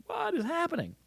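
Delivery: very high, bewildered squeak. Retold or imitated. Everyone reacting to the odd essay, squeaky mock bewilderment. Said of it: "everyone's gonna be like".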